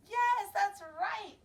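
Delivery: ordinary delivery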